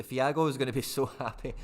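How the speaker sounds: laughing through the words. Real, not acted. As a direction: chuckle mid-sentence. Laughs imagining friend's joy.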